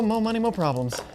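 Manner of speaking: ordinary talk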